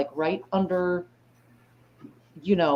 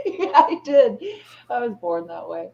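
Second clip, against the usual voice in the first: laughing reply; 4 semitones higher, 5 dB louder than usual. Real, not acted. Laughs answering tease.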